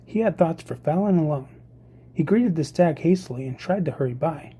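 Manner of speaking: ordinary delivery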